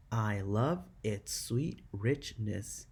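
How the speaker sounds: slow, evenly separated syllables; counting syllables. Shown as a demonstration. Teacher counting six syllables in the third line, slowly one by one. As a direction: space out "I love its sweet richness" syllable by syllable. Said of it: "six syllables in the third line".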